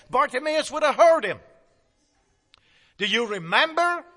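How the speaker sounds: usual speaking voice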